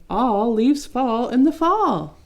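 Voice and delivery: taunting. Acted. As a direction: drag out the words to rub it in. Leaves taunting Leaf.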